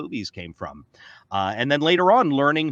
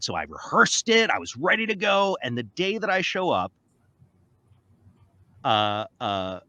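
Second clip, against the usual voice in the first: ordinary talk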